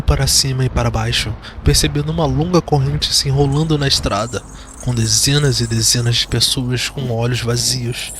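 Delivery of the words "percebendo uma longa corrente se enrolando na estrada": ordinary delivery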